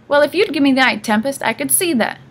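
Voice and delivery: high, irritated grumbling; complaining. A narrator's reading. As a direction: snap back like an old woman driver. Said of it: "the driver complained".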